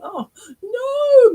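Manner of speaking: high, loud "oh, no"; real dismayed yelp; startled cry. Real, not acted. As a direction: yelp at your own mistake. Sudden slip mid-reading.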